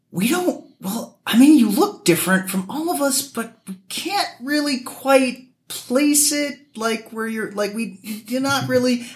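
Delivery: high, slow puzzled voice of people who can't place him; mimicking puzzled locals. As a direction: pitch it up high like a confused local squinting at him. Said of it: "they was like".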